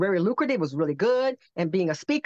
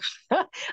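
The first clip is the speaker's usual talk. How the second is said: laugh; 12 semitones higher than usual. Real, not acted. Laughing at herself.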